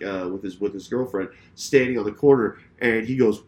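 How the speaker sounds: ordinary talk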